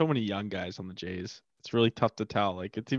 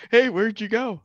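mock host voice; 11 semitones higher, 7 dB louder than usual. Mock family asking.

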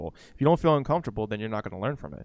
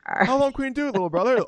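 put-on high, playful kid voice; teasing kid; 13 semitones higher, 6 dB louder than usual. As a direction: tease like a bratty sibling, pitched up. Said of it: "I'm like".

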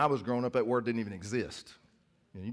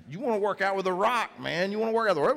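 higher and louder hype; rising excitement, a bit louder; excited; 8 semitones higher, 5 dB louder, faster than usual. Real, not acted. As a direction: pump them up about The Rock. Hyping the Rock.

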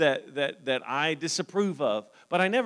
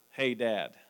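ordinary talk

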